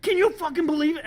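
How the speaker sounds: very high, loud incredulous yell; hammed-up furious disbelief; imitated worked-up rage. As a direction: mimic someone beside himself with outrage. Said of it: "He's so worked up".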